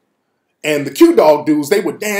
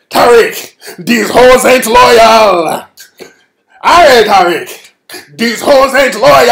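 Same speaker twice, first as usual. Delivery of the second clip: slow, loud, drawn-out Jamaican patois; Jamaican accent; 5 semitones higher, 6 dB louder, slower than usual. Retold or imitated. Jamaican friend grabbing him by the neck, slow loud patois. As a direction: lean in heavy and slow like a tipsy Jamaican friend.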